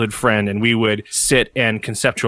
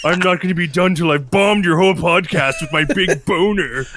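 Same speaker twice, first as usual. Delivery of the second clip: mock villain; 5 semitones higher than usual. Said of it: "No, we must dig deeper".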